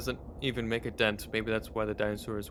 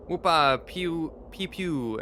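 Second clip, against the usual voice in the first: mock gunshots; 5 semitones higher, 5 dB louder, much slower than usual. Cowboy imitating gunshots.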